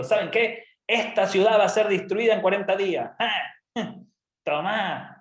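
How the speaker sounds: high, forceful announcement with a jeer; gloating Jonah. Acted. Jonah to the people of Nineveh, gloating. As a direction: proclaim doom, then relish it. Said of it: "Yo me imagino a Jonás diciendo".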